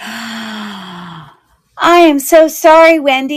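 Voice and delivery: gasp, then a slow, drawn-out apology; shocked apology. Real, not acted. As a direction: caught out by her own mistake, real dismay. Realizes her auction mistake.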